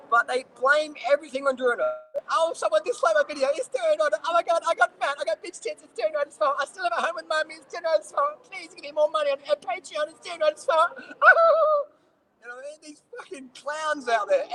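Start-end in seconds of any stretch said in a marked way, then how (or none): 2.3-10.9 high-pitched mocking crybaby voice; whiny complainer. Vlogger mimicking internet critics blaming Bruno, whiny. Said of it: "stop being a little whiny bitch". 11.0-11.8 loud, very high fake wail; mock crybaby wail. Vlogger mocking whiners who blame Bruno, squealed fake wail.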